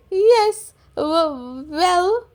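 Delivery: teacher flustered by the lion. Acted. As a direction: stumble nervously. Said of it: "says Madam Gazelle".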